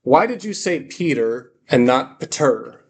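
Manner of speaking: usual speaking voice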